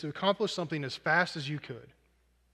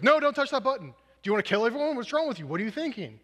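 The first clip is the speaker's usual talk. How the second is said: much higher and louder, scolding in a panic; alarmed angry Rocket; 7 semitones higher, 4 dB louder than usual. Acted. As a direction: yell at the little one to get his hands off. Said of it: "And Rocket goes".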